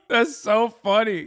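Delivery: slow words through real laughter; drawn-out laugh-filled line. Real, not acted. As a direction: still giggling as you say it. Cracking up at the clip.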